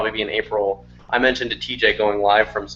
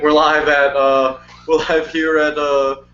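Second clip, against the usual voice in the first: slow, higher mock news reporter; 4 semitones higher, 3 dB louder, slower than usual. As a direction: announce it like a live field report. Parody live report.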